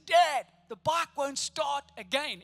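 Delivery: put-on a kid shouting, drawing out "again"; exasperated shout. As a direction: yell it at dad from the yard, fed up. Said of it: "I'd shout in exasperation to my dad".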